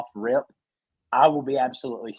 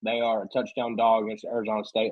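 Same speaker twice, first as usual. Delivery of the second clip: ordinary talk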